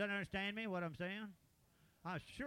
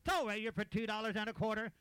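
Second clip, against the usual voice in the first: auctioneer; 4 semitones higher, 4 dB louder than usual. Real, not acted. Auctioneer closing the sale.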